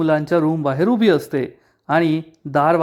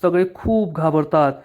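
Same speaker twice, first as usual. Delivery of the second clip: plain reading voice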